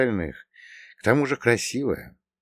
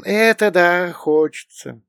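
high, loud, drawn-out agreement; smiling drawl; 10 semitones higher, 5 dB louder, slower than usual. A narrator's reading. Bogdan admitting to his companion he craves meat, smiling drawl. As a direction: stretch it out with a broad smile. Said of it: "расплылся в улыбке и протянул".

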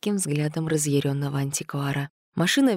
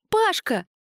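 high shrill call; shriek; 13 semitones higher than usual. A narrator's reading. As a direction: shriek his name. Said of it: "взвизгнула Света".

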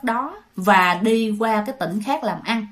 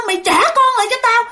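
high, loud, fast ghostly shriek; spooky high-pitched demand; ghost demanding the child; 11 semitones higher, 3 dB louder, faster than usual. Retold or imitated. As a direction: shriek it like a ghost wanting the baby back. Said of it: "nó kêu".

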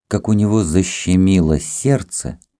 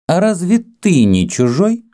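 high, haughty rising question; 12 semitones higher, 3 dB louder than usual. A narrator's reading. Kama, composed now, to the Greek, haughtily. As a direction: challenge him from above. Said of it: "спросила она надменно".

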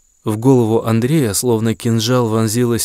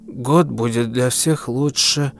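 put-on toothless old granny mumble; mumbling old woman; 2 semitones higher, 4 dB quieter than usual. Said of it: "прошамкала старуха".

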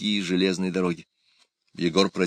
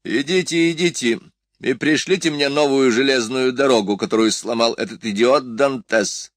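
higher-pitched, mildly cross grumbling; mild grumble; 5 semitones higher, 5 dB louder than usual. A narrator's reading. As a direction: grumble a little as you order them off. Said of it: "проворчал Фрейд". (лёгкое ворчание повышенным голосом)

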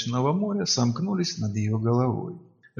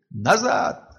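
sharp shout; 5 semitones higher than usual. A narrator's reading. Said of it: "Резкий крик".

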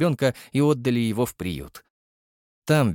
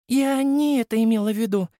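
gently voiced young girl; Nastya's voice; 11 semitones higher than usual. A narrator's reading. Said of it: "вернула меня к происходящему Настя". (высокий девичий голос)

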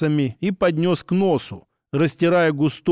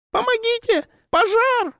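very high squeaky fake woman's voice; squeaky falsetto. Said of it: "Он постарался сделать голос как можно писклявее".

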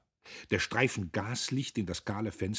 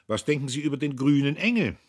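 loud, quick, raised-pitch question; pointedly loud; 8 semitones higher, 11 dB louder, faster than usual. A narrator's reading. Man asks Käthchen about the Green Angel, pointedly loud. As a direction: ask it so the room can hear. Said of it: "sagte er recht laut".

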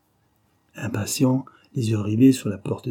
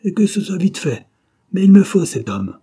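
high, loud commanding yell; shouting; 7 semitones higher, 7 dB louder than usual. A narrator's reading. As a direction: bark the orders, rising in force. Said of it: "il cria".

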